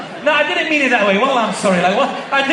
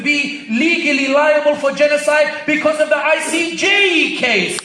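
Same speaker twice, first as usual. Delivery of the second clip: loud, high, driving sermon voice; rousing pulpit delivery, higher and louder; fiery preaching; 9 semitones higher, 5 dB louder than usual. Acted. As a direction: preach it to the back of the hall. Preaching at full force.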